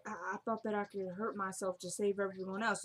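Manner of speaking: fading, weak, losing blood; faint delirious. Retold or imitated. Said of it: "she could barely talk".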